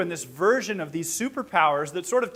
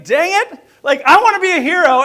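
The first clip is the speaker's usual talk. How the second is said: loud, high-pitched comic whine of thought; mock frustrated protest; 10 semitones higher, 11 dB louder than usual. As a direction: protest to yourself, voice shooting up. His inner protest at being thanked.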